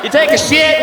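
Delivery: normal talking voice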